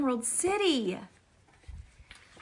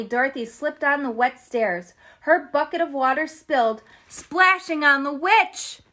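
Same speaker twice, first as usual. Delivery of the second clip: ordinary delivery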